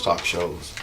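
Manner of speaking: quiet British vowels on shows; British accent. Shown as a demonstration. British voice demo for viewers. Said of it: "That's my British voice".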